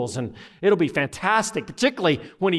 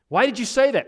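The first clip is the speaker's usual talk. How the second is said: high-pitched mock scolding question; raised pitch, exasperated acted question; mama's demand; 8 semitones higher, 4 dB louder than usual. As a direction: play a mother demanding an explanation.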